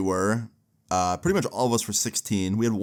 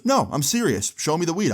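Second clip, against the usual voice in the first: retold insistence; 7 semitones higher than usual. Retold man insisting angrily.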